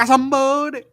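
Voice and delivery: loud high mock shout of one word; mock yell. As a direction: shout it like a cartoon. Repeats the yelled quote.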